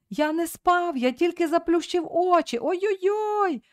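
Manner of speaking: put-on a boy cries out while being whipped; squealing in pain. A narrator's reading. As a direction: yelp out the words as the strap lands. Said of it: "верещав з болю хлопець".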